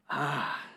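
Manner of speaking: soft, falling sigh. A narrator's reading. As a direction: let out one long "ah". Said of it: "sighed Basil".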